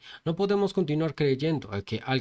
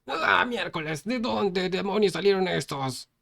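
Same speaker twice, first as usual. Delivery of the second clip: high, startled cry of fear; frightened cry; 8 semitones higher, slower than usual. Acted. Coward leader seeing new arrivals, frightened cry. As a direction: yelp in panic at the intruders. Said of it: "gritó de miedo".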